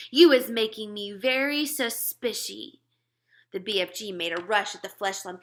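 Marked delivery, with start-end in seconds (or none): 0.1-2.8 louder suspicious bellow; giant bellowing. Acted. Giant threatens the BFG.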